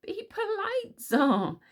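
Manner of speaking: high, pained plea; agonized. A narrator's reading. Caliburn begging Tsar to be polite, agonized. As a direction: plead in agony for good manners. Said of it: "said Caliburn in an agonized fashion".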